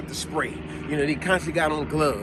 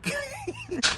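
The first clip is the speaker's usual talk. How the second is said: real burst of high laughter. Real, not acted. Laughing at the story.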